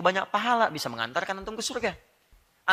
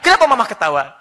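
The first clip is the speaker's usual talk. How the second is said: baffled husband; 7 semitones higher, 14 dB louder than usual. Retold or imitated. Husband voiced, baffled and loud.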